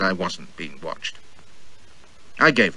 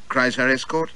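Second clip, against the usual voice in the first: ordinary delivery, nothing marked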